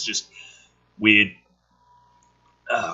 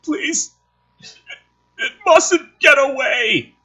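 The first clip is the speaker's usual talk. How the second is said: high, loud crying plea, slow; dying medtech; 5 dB louder, slower than usual. Acted. Dying medtech begging Casey, high crying shout. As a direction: beg through tears with the last breath. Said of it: "Dying medtech".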